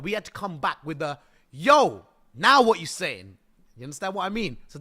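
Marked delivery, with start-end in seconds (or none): 1.5-3.4 loud, drawn-out challenge; defiant comeback. Acted. Developer answers the people trashing the game, defiant comeback. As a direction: throw down a loud, swaggering challenge.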